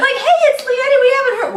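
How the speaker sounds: excited self-imitation, pitched well up; mock excited greeting. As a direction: pipe up high and excited. Acts out own delighted reaction.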